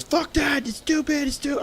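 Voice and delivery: very high mock yelling; imitated screaming. Brother dismissing the gas deal answer, imitated yell. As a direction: shout it down as the ranting brother. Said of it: "screaming the top of his lungs".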